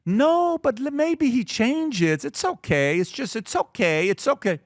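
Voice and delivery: drawn-out soothing reassurance, mock-gentle. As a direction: coax it like a too-easy parent. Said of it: "Americans are like".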